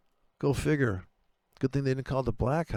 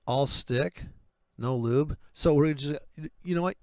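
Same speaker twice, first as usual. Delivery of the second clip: ordinary talk, nothing marked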